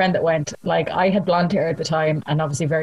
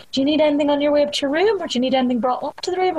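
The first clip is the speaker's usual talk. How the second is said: polite hotel staff; 9 semitones higher, faster than usual. Retold or imitated. Overly helpful hotel staff quoted.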